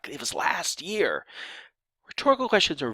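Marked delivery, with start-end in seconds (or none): 0.1-1.2 slow, higher-pitched stress on "last year"; emphasis on last year. Shown as a demonstration. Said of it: "you're trying to emphasize that".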